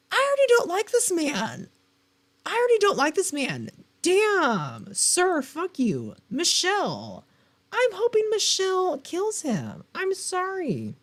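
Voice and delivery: high, loud, indignant outburst; outraged. Real, not acted. Reacting to the murderous husband in the blurb, loud and outraged. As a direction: shriek your disgust at the character.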